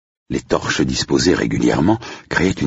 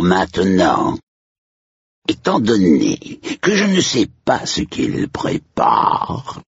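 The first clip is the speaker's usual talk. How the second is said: slow, drawn-out spooky announcer; theatrical ghoul stretching out a warning; menacing hellish majordomo; 3 semitones higher, slower than usual. Acted. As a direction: swell from ominous calm to a high shout. Majordomo's announcement before fireworks.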